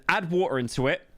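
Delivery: normal talking voice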